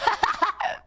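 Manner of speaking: very high, short real laugh; burst of laughter. Real, not acted. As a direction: lose it for a moment in high laughter. Cracking up at an emote.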